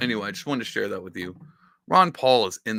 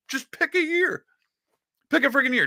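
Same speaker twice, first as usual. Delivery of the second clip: 1 high excited insistence. Real, not acted. Insisting Ron Paul was right.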